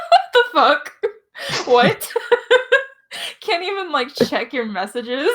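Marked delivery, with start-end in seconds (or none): none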